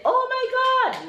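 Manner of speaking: very high falling oh my God; excited exclamation. Shown as a demonstration. Mother showing when to use the phrase, very high amazement. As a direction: cry it out in amazement. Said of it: "where you would use that Jamaican expression".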